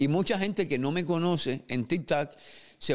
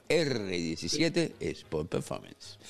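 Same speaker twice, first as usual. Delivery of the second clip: slow, low announcer tag; 8 semitones lower, 4 dB quieter, much slower than usual. Acted. The host closing his joke ad with a deep, slow brand sign-off. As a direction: drop the voice low and draw out each word of the brand. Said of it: "este es un anuncio no pagado".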